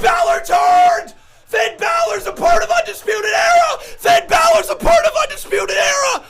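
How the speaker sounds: high, loud, frantic fan screams; screaming in disbelief. Real, not acted. Fan screaming at the TV as Balor turns heel, frantic. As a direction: lose it completely, shrieking at the screen.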